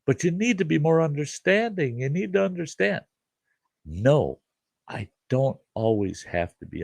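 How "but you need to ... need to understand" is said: high, pleading whine imitating the brother-in-law; a mocking high whine, begging to be understood; whiny mock plea. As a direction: mimic him whining for sympathy, pitched way up. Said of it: "I must have heard fifteen, twenty times".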